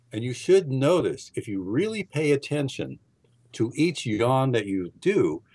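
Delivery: usual speaking voice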